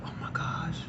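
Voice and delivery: breathy stage whisper. Retold or imitated. Friend whispering about the smell in the car. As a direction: hush it so she can't hear.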